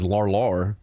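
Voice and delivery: imitated English pronunciation of "lar lar"; mock English accent. Streamer mimicking a chatter from the UK, mock English accent. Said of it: "said that shit in an English accent".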